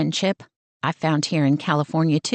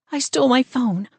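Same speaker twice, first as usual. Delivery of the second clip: breathless fear; 6 semitones higher than usual. Acted. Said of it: "trying to catch her breath".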